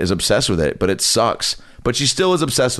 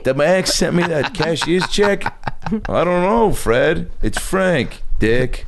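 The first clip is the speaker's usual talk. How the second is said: put-on higher, slow brash New York accent; crude New York host; 8 semitones higher, much slower than usual. A pretend kids' host bickering with a neighbour in a high, brash New York voice. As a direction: trade insults like a foul-mouthed Noo Yawker. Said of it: "Andrew Dice Clay does it".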